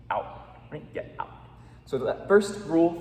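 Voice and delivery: usual speaking voice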